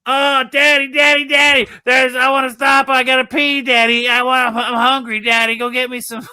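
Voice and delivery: put-on high, loud nagging child; whining kids. Kids in the car nagging their dad, high and whiny. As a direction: pester daddy like a restless kid in the back seat. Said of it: "they're like".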